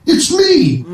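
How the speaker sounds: very high squeaky punchline; plumber's punchline. Acted. Limerick's plumber answering his girl, very high squeak. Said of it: "Said the plumber, still plumbing".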